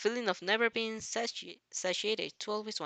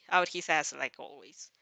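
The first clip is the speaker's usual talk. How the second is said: ordinary delivery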